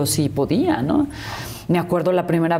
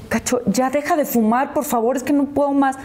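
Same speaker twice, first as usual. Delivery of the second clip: pleading; 7 semitones higher than usual. Retold or imitated. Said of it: "le digo".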